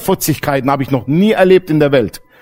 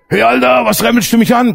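higher, louder snap of a hothead; aggressive youth; 8 semitones higher, 3 dB louder than usual. Retold or imitated. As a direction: bark it at whoever bumped you. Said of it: "Gleich so".

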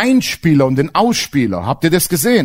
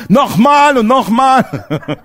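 laughing; 6 semitones higher, 4 dB louder, much slower than usual. Real, not acted. Laughing at his own annoyance.

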